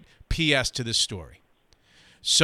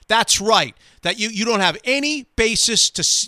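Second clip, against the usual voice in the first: angry retort; 7 semitones higher, 4 dB louder than usual. Retold or imitated. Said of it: "angry quote of Digger".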